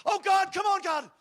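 a desperate father yelling at God; desperate shouted prayer. Retold or imitated. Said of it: "I started feeling like".

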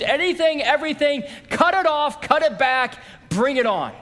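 fervent plea. Retold or imitated. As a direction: pitch leaps up in eager surrender. Voicing a fervent surrender prayer.

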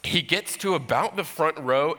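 ordinary delivery

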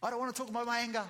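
high, loud, fast frustrated snap; imitating a frustrated outburst; frustrated retort. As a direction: fire it back, fed up and defensive. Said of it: "And then get really frustrated".

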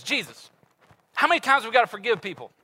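high, loud eager Peter asking Jesus; eager question. Retold or imitated. Peter eagerly asks Jesus, high and loud. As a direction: pipe up like the teacher's pet.

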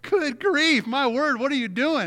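high, loud startled outburst; exasperated exclamation. Retold or imitated. Father startled by his kicking, screaming toddler, high exasperated outburst. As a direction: blurt it out at the flailing toddler. Toddler daughter throwing a tantrum.

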